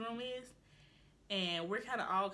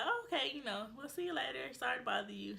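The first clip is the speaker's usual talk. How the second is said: high, airy brush-off; imitated polite goodbye; 7 semitones higher than usual. Group letting a passing girl go, high polite goodbye. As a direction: act out a casual, apologetic sign-off.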